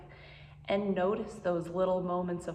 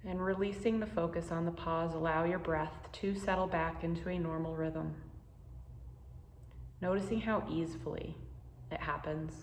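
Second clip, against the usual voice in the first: guided meditation; 5 dB quieter than usual. Acted. Teacher guiding listeners through a pause practice, calm and unhurried. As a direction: ease the listener into calm, one breath at a time.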